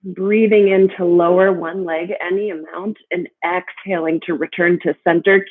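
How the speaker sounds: ordinary talk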